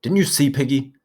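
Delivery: high, feverish and disgusted; half horror, half thrill; feverish loathing. A narrator's reading. Ralph pressing Piggy, feverish and loathing. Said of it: "loathing ... feverish excitement in his voice".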